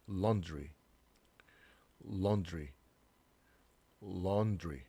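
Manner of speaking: "laundry" as LAWN-dri; "laundry" with one aw vowel up front. Shown as a demonstration. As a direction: say "laundry" with a rounded aw. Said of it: "이렇게 읽으면 돼요".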